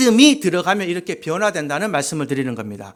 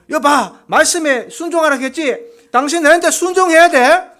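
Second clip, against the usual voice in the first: loud, higher, domineering demand; bullying husband; 7 semitones higher, 8 dB louder than usual. Retold or imitated. Authoritarian elder husband browbeating his wife, loud and domineering. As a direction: bark orders like an old-style authoritarian husband. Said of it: "이렇게 막 윽박질렀는데".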